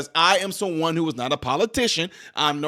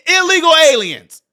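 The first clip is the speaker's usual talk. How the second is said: "illegal aliens" shouted higher and louder; shouted defiance; 7 semitones higher, 7 dB louder than usual. Real, not acted. Vlogger defiantly shouting the term he insists on. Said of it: "You're not gonna make me".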